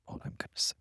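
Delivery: soft conspiratorial whisper; whispered tease. Pretend. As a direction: breathe it like a secret. Teasing repeated refusal to tell.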